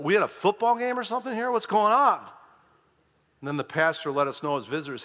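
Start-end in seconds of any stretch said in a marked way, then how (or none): 0.0-2.2 high, louder incredulous question; acted bafflement, building louder; bewildered questioning. Visitor puzzled by cheering at offering, bewildered.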